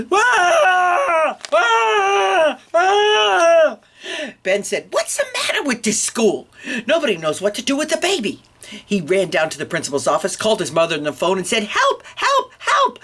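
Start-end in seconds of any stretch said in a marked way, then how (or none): 0.1-3.8 put-on full-force high baby wail; baby yelling. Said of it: "it yelled as loudly as it could". 4.9-8.4 high-pitched frustrated outburst; exasperated boy. Acted. Ben exasperated with the adults. 11.6-13.0 very high cries for help; urgent plea. Acted. Ben phones mother for help.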